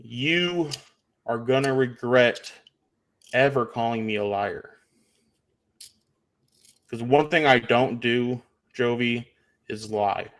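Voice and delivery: slow, heavily spaced words for a dimwit; deliberately slow. Real, not acted. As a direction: speak slowly, with long pauses between phrases. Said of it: "so I'll speak slowly".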